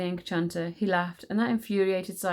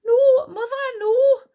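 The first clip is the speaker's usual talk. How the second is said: very high, loud protest; crying out; 10 dB louder, slower than usual. A narrator's reading. Wish protesting to her mother, crying out. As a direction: cry out high in alarm. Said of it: "cried Wish".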